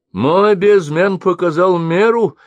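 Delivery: high, loud, slow protest; arguing merchant. A narrator's reading. Merchant disputes the weighing with the mercenary, arguing. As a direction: insist stubbornly, voice up high. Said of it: "заспорил гость".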